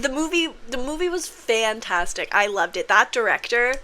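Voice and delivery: ordinary talk, nothing marked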